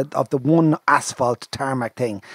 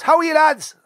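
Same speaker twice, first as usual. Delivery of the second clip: put-on Westmeath accent; 5 dB louder than usual. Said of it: "country accent, Westmeath".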